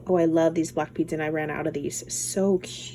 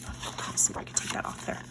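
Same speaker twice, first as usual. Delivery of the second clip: whisper; 11 dB quieter, much faster than usual. Real, not acted. Whispered aside while unboxing.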